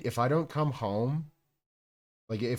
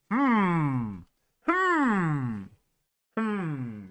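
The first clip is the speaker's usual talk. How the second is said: suggestive hmm; 7 semitones higher, 5 dB louder, much slower than usual. Retold or imitated. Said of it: "I'm just gonna be like".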